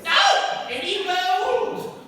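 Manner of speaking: very high, loud hammy gasp of a no; mock shock. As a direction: overplay the shock. Actor's exaggerated reaction.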